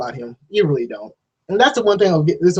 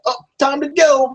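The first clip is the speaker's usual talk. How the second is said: mock hurry; 9 semitones higher than usual. Voicing the fleeing heroine.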